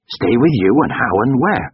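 high, indignant questioning; scornful retort. A narrator's reading. Lover rejecting the plea to stay, scornful and indignant. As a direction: fire the offer back in disbelief.

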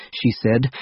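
plain reading voice

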